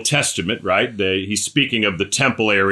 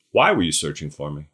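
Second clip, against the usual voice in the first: much lower, calm, unbothered "Why were you searching for me?"; low deadpan question; 10 semitones lower, 4 dB quieter than usual. Shown as a demonstration. Boy Jesus calmly questioning his worried parents, low and aloof. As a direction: drop low and let it sit, aloof. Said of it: "the first recorded words of Jesus".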